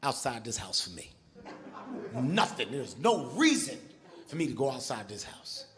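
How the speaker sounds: low, flat insistence; stubborn refusal. Retold or imitated. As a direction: dig in and refuse, voice dropped low. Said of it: "I said".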